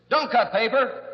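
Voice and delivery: high, loud order to the patient; mock shouted command. Therapist orders his patient to stop cutting paper, mock shout. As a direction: bark it out as the comic cure.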